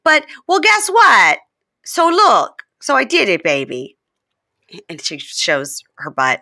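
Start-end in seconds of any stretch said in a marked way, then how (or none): 0.0-3.9 higher, louder confessing voice; mildly hammed-up confession; imitating Jasmine. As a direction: blurt it out in a mock Jasmine voice. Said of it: "And she goes".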